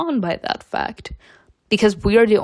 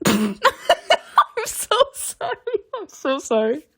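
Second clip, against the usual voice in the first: laughing apology; 8 semitones higher than usual. Real, not acted. Player sorry for her daddy joke, laughing. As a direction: apologise through real helpless laughter.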